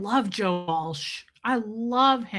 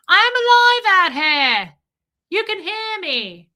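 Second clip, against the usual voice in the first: high, loud, drawn-out mock cry for help; mock Rose calling; 10 semitones higher, 8 dB louder than usual. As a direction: ham up a shout from the water. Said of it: "she's like".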